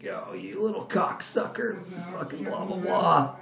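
high, slow mock outrage from the boss; hammed-up furious boss cursing him out; imitating angry boss. Boss cursing out employee who bids against him, mock furious.

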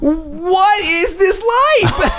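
high, slow laughing shout; laughing disbelief. Retold or imitated. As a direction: cry it out through laughter.

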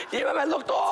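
hammed-up terror of a guy tripping; mock panic. Drugged friend begs the table to take him to the doctor, mock panic.

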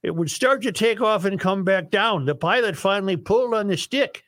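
bad singsong Norwegian for the joke; worst Norwegian voice. Host telling Sven and Ole joke, worst Norwegian accent. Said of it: "use your worst Norwegian voice".

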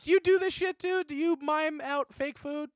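high-pitched exasperated quote; incredulous mock question. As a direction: act out baffled exasperation, high. Acts out annoyed question to relatives.

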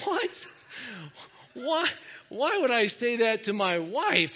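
high-pitched, incredulous squawk of protest; baffled disbelief. Retold or imitated. Husband reacting to the marriage conference speaker, comic disbelief. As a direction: balk in comic bewilderment, pitch leaping up.

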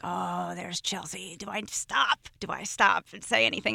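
soft, retold thinking to herself; hushed self-questioning, lightly acted; hesitant inner debate. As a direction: lightly act out quiet indecision. Retells her hesitant inner debate.

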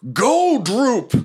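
high shouted order; bugbear command. Acted. Bugbear shoving the goblin into the fight, high shout. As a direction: hurl the goblin forward with a yell. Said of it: "he says".

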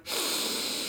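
long contented sigh; blissful sigh. Real, not acted. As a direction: sigh with pleasure. Smelling new game box.

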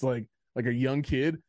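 ordinary talk, nothing marked

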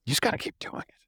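whispered aside, no voice. Real, not acted. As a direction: breathe it out as a secret. Aside before repeating the point.

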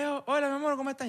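high, sugary hello to the ex; mock sweet greeting. To his ex at the birthday table, mock sweet and high. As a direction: greet her with exaggerated sweetness. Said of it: "y que".

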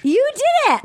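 high-pitched cheering exclamation; thrilled insistence. Real, not acted. Host overrules cohost's doubt about the accent, thrilled high cry. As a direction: insist with squealing delight.

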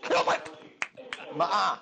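very high-pitched holler to someone nearby; calling to wife. Real, not acted. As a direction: call out urgently for the alarm to stop. Calling wife to silence radio.

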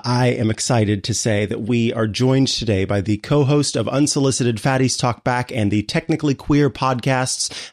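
usual speaking voice

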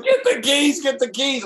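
high, urgent imitation; mock frantic pleading, repeated; Dutch in a panic. Driver imitating his soaked passenger, urging him on.

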